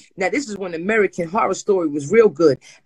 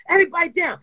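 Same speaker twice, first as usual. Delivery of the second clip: police order; 9 semitones higher, faster than usual. Retold or imitated. Acts police raid order.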